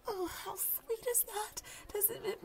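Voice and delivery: high, gushing, almost crying with delight; swooning squeal. Acted. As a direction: melt over how sweet he is. Swooning over crush.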